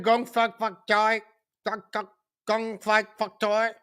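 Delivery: mocking her Gong Fat Fat Choi; mocking mispronunciation. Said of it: "she said".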